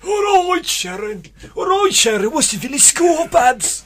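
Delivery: loud, high, hammed-up Yorkshire greeting; Yorkshire accent. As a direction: bellow a Yorkshire hello to Sharon. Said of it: "He's like".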